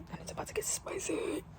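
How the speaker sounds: hushed, teasing whisper; soft and secretive; conspiratorial whisper. Real, not acted. Teasing a racy scene to come.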